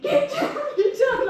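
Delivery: very high, panicked-style retold scream; hammed-up urgent yell; mock screaming. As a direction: act out screaming in a windstorm. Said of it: "I was screaming, and my husband was like".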